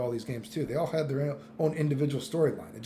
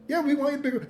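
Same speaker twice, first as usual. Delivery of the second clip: higher, put-on voice of society agreeing; mock society voice; 10 semitones higher, much faster than usual. Streamer voicing society answering Bridget, mock eager. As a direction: play the eager crowd saying yes. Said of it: "They're like".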